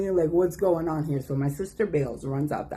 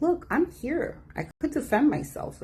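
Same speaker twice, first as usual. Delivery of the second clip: higher, firm retelling of herself; higher self-defence; calm confronting; 11 semitones higher than usual. Retold or imitated. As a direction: stand your ground, voice lifted. Confronting family in living room.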